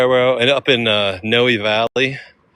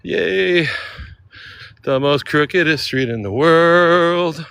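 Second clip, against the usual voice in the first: excited; 6 semitones higher, 3 dB louder, slower than usual. Real, not acted. Announcing the famous street.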